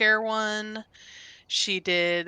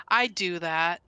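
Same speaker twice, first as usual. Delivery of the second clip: normal talking voice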